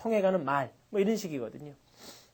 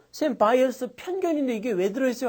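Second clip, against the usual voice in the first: higher curious student voice; mimicking a student; 4 semitones higher than usual. Teacher mimicking a student's question in class. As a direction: play the puzzled student asking. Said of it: "하던데".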